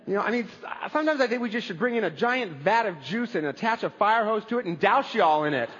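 ordinary delivery

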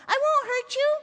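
put-on high, loud boy's shout; child shouting after someone; calling out. A narrator's reading. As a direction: call reassurance across a distance. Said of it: "he called".

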